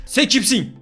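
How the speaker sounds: high, loud demanding question; character voice. Acted. Character challenging the hero, high and loud. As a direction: bark it up high at the stranger. Voicing game character asking.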